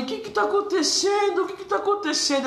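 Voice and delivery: the alarmed mom demanding answers; mother asks what is happening, high and frantic; panicked mother. Retold or imitated. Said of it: "E minha mãe".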